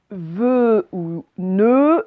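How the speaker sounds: vœu et nœud, E fermé très allongé. Shown as a demonstration. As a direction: arrondir la bouche sur vœu et nœud, lentement. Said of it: "ma bouche est toute ronde". (vœu, nœud : E fermé, tenu)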